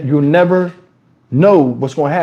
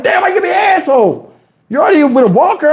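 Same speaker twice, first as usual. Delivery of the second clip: exasperated yell; 12 semitones higher than usual. Retold or imitated. Telling off the old lady at the casino, exasperated half-yell. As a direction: throw your hands up and yell it.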